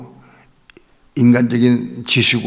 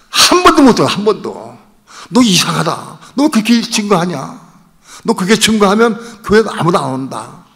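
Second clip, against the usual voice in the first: mock indignant mother, higher and louder; indignant scolding; 7 semitones higher, 4 dB louder than usual. Mother scolding her preaching son, indignant. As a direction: scold in loud, high-pitched disbelief.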